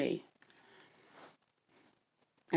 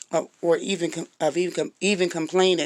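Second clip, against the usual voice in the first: tripping over the words, morning stammer. Real, not acted. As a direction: stumble and restart the word. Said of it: "excuse me, y'all, that morning tone".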